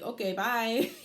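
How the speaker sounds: breaks into a high loud laugh; real laughter after a flippant line. Real, not acted. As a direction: dismiss it, then crack up laughing. Dismissive reply then laughing.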